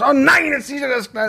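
high-pitched mock wail; exasperated parent outburst. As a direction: re-enact yelling over a child's spill. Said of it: "wenn die Kinder was umgekippt haben".

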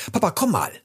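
high, carrying call for dad. A narrator's reading. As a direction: summon a father from far below. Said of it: "rief Rocco ganz laut". (laut nach Papa rufend)

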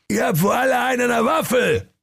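hoher, wütender Königsschrei; außer sich brüllen; wütender König. Acted. König tobt vor Wut. (wütender König schreit)

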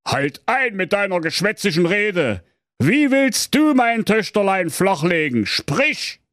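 king commanding a suitor; stern king. Acted. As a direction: order him to speak up. King rebukes prince.

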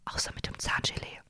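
hushed whisper, barely voiced; whispered confession. Real, not acted. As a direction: whisper it like a guilty secret. Confessing ignored dental advice.

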